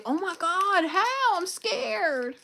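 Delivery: high, mock-frightened voice; mock scared mother. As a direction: pretend to be scared, hammed-up. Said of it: "And she might say".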